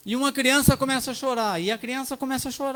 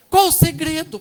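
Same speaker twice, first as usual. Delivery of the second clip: asked again, much louder and higher; louder repeat; 8 semitones higher, 9 dB louder than usual. Real, not acted. Loud: pastor presses the couple again, calling it out. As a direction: call it out so the whole church hears.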